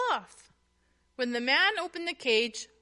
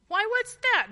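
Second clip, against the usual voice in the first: high, loud surprised question; 9 semitones higher, 6 dB louder than usual. A narrator's reading. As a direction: caught off guard, ask quickly. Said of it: "Nancy asked in surprise".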